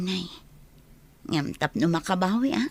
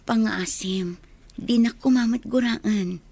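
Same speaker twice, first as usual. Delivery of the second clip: whisper; 2 semitones higher than usual. Acted. Hushed plea.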